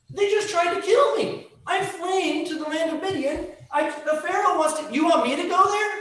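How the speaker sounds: high, anxious protest as Moses; pleading Moses. Acted. Moses protesting to God, high and pleading. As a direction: Moses backing away from the task. Moses objects to God's call.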